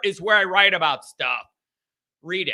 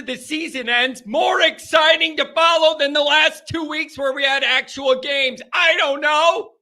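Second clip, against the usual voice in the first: high-pitched, worked-up questioning; exasperated rant; 6 semitones higher than usual. Real, not acted. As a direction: fire off baffled questions in a raised pitch. Exasperated rant over off-season rumors.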